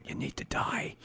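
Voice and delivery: whispered death threat. Retold or imitated. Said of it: "You're annoying".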